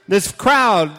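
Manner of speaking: hammed-up mob roar; mob shout. Preacher voicing the angry mob, loud mock shout.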